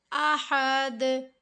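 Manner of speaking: अहद with the final द bounced, echoing back; echoing qalqala. Shown as a demonstration. Teacher showing students the qalqala, slow bounced ending. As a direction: make the द in अहद rebound. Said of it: "दे की जो आवाज़ आ रही है".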